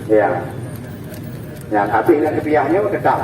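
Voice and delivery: ordinary delivery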